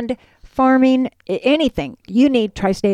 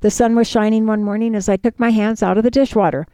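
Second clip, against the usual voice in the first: ordinary delivery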